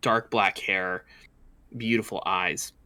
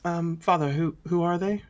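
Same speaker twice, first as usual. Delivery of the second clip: much higher princess voice; princess asking; 8 semitones higher than usual. Acted. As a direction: ask father like a curious young princess. Princess asks her father.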